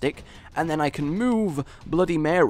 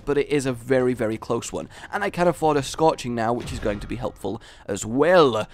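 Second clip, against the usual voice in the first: ordinary delivery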